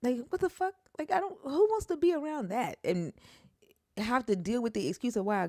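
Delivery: high, loud exasperated outburst; high incredulous anger; outraged. Real, not acted. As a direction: let the outrage pour out. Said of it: "venting outrage".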